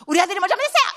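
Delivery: high, loud, indignant parent's challenge; indignant demand. Retold or imitated. Mother confronting her son's teacher, high indignant challenge. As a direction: snap back at the teacher defending your son.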